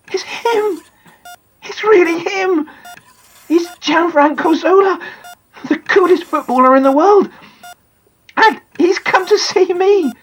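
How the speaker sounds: put-on high-pitched, thrilled boy; overwhelmed at meeting a hero; breathless-with-wonder young fan; awestruck excitement. Boy recognises his visiting hero.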